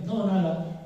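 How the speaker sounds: amigo esquivo. Acted. Amigo reacio, voz actuada.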